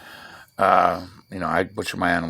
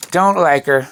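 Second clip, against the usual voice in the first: disgusted; 7 semitones higher, 7 dB louder than usual. Real, not acted. Spits out dislike of her.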